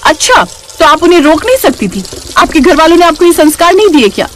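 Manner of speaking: high-pitched, accusing sister-in-law; rude, outraged young woman's role; indignant Naina. Acted. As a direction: throw it back as an insult. Naina attacks bhabhi's upbringing.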